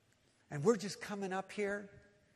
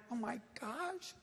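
softer, slow, higher-pitched imitation of an overwhelmed man; mock tearful awe; 6 semitones higher, 4 dB quieter, slower than usual. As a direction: gasp it softly as if moved to tears. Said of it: "Simeon captures that in tears".

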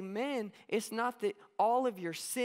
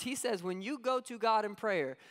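ordinary talk, nothing marked

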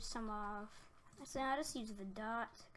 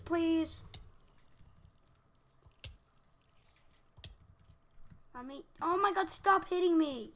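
loud, higher, frantic pleading; real desperate pleading; 5 semitones higher, 10 dB louder than usual. Real, not acted. Player being beaten by mobs, desperate shouting. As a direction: beg the mobs to stop.

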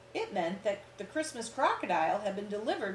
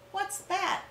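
put-on curious child; 11 semitones higher than usual. Alice Jane asks curiously.

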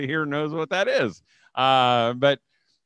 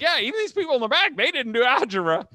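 high mock remark; 11 semitones higher than usual. Teasing remark about people in back.

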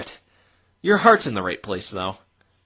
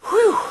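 high whistling sigh of relief; big relieved whew after the meeting; 6 dB louder than usual. Acted. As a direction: let the tension out in one loud whew.

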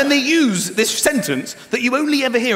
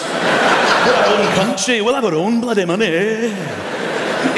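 mock Scottish defiance; 7 dB louder, slower than usual. Mock Scot demanding own money.

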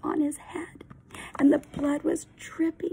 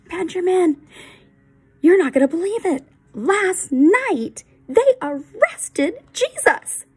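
high, loud and breathless with news; excited news; 6 semitones higher, 9 dB louder than usual. Acted. Friend runs up to Benjamin, excited and loud. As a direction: burst in with the big news.

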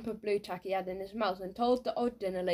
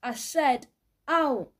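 loud shout, peaking on OUT; pause, then bellow the last word high; shouted order; 5 semitones higher, 7 dB louder, much slower than usual. A narrator's reading. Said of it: "shouted Missus Trafe".

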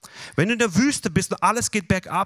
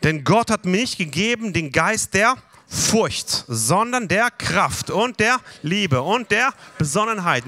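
slow declaration; 3 semitones higher, 3 dB louder, slower than usual. Shown as a demonstration. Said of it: "Was sagst du dann?".

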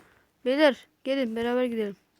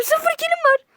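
mock squeak; 6 dB louder than usual. Joking brag about zero kills.

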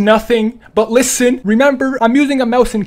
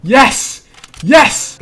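cheering yes; 5 dB louder than usual. Real, not acted. Gamer cheering a win.

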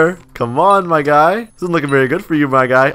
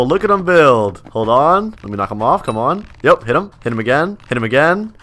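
ordinary talk, nothing marked

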